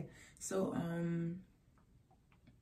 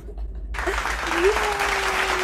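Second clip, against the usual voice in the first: loud laugh; 12 semitones higher, 14 dB louder than usual. Real, not acted. Laugh after sarcastic cheer.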